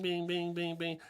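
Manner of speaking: high sung bings copying a xylophone note; xylophone note imitation. Coach mocks the xylophone kid's repeated note, high and sing-song. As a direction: mimic a kid hitting one xylophone note over and over. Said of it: "doing that bing".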